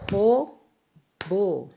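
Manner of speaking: "Robô" broken into two clear syllables; split into syllables. Shown as a demonstration. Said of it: "Vamos separar comigo?". ("Robô" separado em duas sílabas, mais alto)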